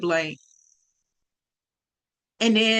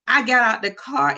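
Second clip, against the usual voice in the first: usual speaking voice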